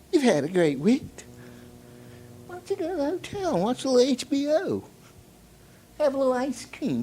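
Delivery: put-on high, soft, tempting old man voice. Old man tempting the salesman to quit early, high coaxing voice. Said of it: "an old man said to me".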